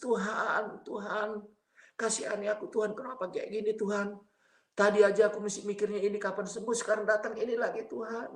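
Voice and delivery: high-pitched imploring prayer, as if weeping; tearful pleading, re-enacted. Retelling his prayer to God in pain, tearful pleading. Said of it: "Saya menangis, menangis".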